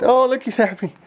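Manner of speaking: cooing. Real, not acted. Cooing over the dog.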